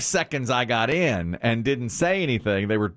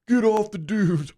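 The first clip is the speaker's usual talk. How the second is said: son mimicking neighbor; 9 semitones higher than usual. Son teasing dad on the beach, higher mock yell. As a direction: tease dad with the neighbor's line. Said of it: "my son would go".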